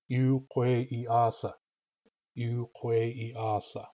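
Yu and Kwe held long, I short; nailed-down word rhythm. Shown as a demonstration. Teacher putting sounds back in, slow measured rhythm. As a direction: keep long-long-short-long-short through Yu, Kwe, I, Atha. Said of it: "put the vowels and consonants back in and say".

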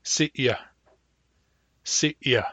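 glottalized y in "sitya"; hard Y. Shown as a demonstration. Teacher giving the example word for hard Y, glottalized "sitya". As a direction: cut "sitya" with a glottal catch before the y. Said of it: "Hard Y".

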